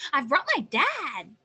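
loud, high little-wolf reveal; spring the surprise with a shout; triumphant announcement. Acted.

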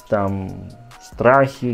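ordinary talk

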